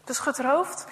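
ordinary delivery, nothing marked

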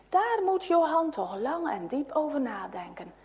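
plain reading voice

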